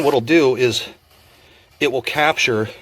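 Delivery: usual speaking voice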